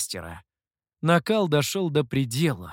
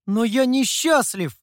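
higher, louder protest; raised-voice youthful protest; objecting; 8 semitones higher, 5 dB louder than usual. A narrator's reading. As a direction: object with feeling. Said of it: "снова возразил Сяо Ту".

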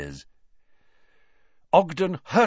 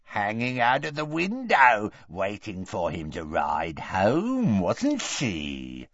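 ruthless taunting; slower than usual. A narrator's reading. Morfin exposes his terrified sister, ruthless. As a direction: press on cruelly, sliding downward. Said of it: "but Morfin went on ruthlessly".

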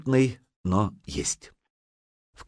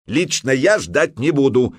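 slow, emphatic raised voice; shouted defiance; 5 semitones higher, 5 dB louder than usual. A narrator's reading. Грейпюр refuses to await fate, shouting. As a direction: cry out your refusal to wait. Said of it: "крикнул Грейпюр".

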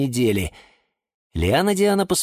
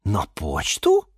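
slow, questioning echo with a rise; understated astonished question; surprised; slower than usual. A narrator's reading. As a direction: repeat it in puzzled wonder. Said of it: "удивились все".

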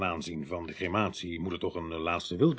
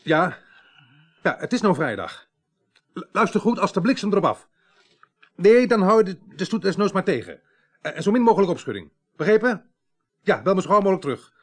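raised, higher voice, rapping out orders fast; urgent loud orders; 9 semitones higher, 8 dB louder than usual. Acted. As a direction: push each command down the phone line. Chief barks orders down phone.

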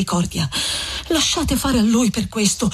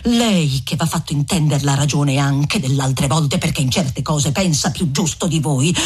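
put-on a lowered, grumbling young man pushing back; Renzo's voice; 8 semitones lower than usual. Renzo arguing with Lucia, in a lowered grumbling voice.

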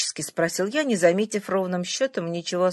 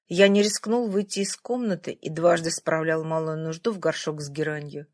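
plain reading voice

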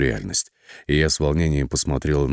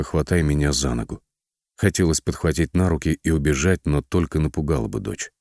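usual speaking voice

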